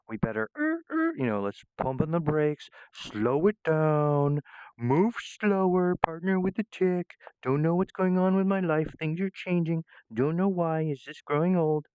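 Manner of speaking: high, stretched-out mock anxiety; hammed-up fretting [name]. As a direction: squeal it out like a flustered old worrier. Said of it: "false modesty".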